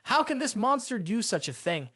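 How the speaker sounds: usual speaking voice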